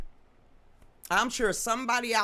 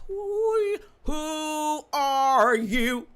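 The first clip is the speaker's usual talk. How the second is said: slow, drawn-out eerie who are you; spooky voice; 7 semitones higher, 6 dB louder, much slower than usual. Pretend. Asking the viewers who they are, slow spooky voice. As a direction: stretch every word like a ghost.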